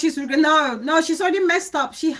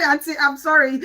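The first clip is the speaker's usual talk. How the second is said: ordinary delivery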